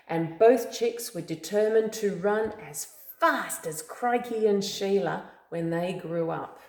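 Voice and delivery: usual speaking voice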